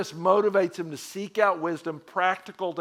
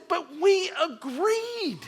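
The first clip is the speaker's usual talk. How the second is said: slow, high, pleading excuse; defensive husband, higher; 12 semitones higher, much slower than usual. Retold or imitated. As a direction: stretch it out like a confused groom. Husband defends himself to wife.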